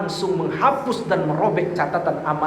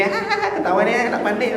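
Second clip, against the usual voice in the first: high, teasing laugh through the words; mocking laughter; 4 semitones higher than usual. Preacher miming people who tease beginner readers, mock laughter. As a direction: jeer with a put-on hahaha.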